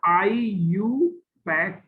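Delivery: slow, drawn-out "आईयू पैक", ending in pack; IUPAC as a word. Shown as a demonstration. Teacher quoting the book's pronunciation, slowly demonstrated. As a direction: stretch "आईयू", then "पैक" as one syllable. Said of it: "जब इसको pronunciation किया जाए".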